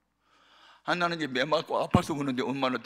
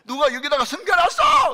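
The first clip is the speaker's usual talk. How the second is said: much higher, much louder furious yell; the father demands who hid the fruit, shouting; angry shout; 13 dB louder than usual. Retold or imitated. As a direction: bellow it at the whole house.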